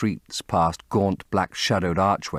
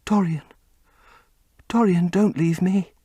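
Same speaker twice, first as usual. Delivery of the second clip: plain reading voice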